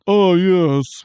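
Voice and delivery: snooty critic voice; languid pretentious art snob. Pretend. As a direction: purr it like a pompous critic. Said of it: "Mister Snooty, uh, critic mode".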